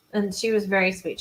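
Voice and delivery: usual speaking voice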